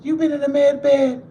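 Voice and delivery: high, loud teasing friend voice; ribbing him about looking rested; mimicking Shipper. Retelling friend Shipper teasing him, high and loud. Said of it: "he talking about".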